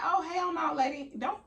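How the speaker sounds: squealed hell no, lady; high mock warning to the nurse; high-pitched refusal. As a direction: shoot her down in high disbelief. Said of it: "So I'm like".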